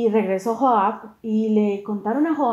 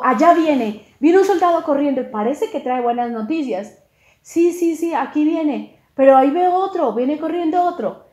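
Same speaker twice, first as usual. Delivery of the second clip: excited sentinel, higher and louder; acted lookout call; 5 semitones higher, 6 dB louder than usual. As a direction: call out a sighting from the watch. Centinela anuncia corredores, actuado. (grito animado de centinela)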